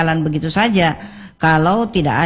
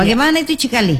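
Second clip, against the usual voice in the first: Indonesian with a higher Makassar lilt; Makassar accent; 6 semitones higher, much faster than usual. Shown as a demonstration. Showing how youngsters mix Indonesian and dialect, light Makassar accent. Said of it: "logat-logatnya saja seperti".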